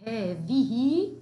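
slow teacher modelling; slow drawn-out model word. Shown as a demonstration. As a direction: stretch each sound slowly. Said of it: "هيا معا نكرر الكتابة".

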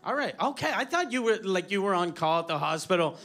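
ordinary talk, nothing marked

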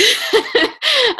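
high bright burst of laughter. Real, not acted. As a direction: laugh it off, embarrassed. Laughs at being winded.

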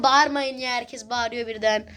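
ordinary talk

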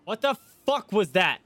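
shocked outburst. Real, not acted. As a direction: blurt it out after a jump scare. Jump scare in game.

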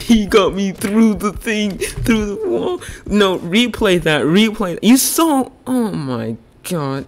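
high, slow, loud whine; whiny protest. Real, not acted. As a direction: plead in disbelief. Complaining after unfair hit.

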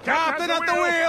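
high, loud announcement to the deck; ringing shout to the crew; calling out. Acted. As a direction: call it out across the ship. Crew calls out at sea.

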